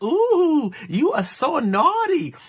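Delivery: high-pitched teasing coo; mock giggly flirting. Giggly honeymooners teasing each other in the steam room, mock flirty. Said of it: "You can hear them giggling like".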